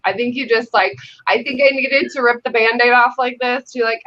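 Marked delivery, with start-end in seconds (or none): none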